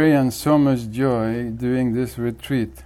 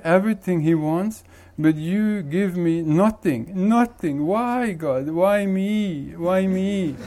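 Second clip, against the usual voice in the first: higher-pitched, self-pitying complaint to God; mock whiny lament, voice pitched up; whining; 7 semitones higher than usual. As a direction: whine it like a hard-done-by man.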